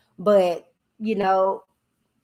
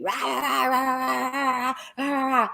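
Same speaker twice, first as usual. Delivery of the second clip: mock rah-rah chatter; 6 semitones higher than usual. Mocking people's chatter.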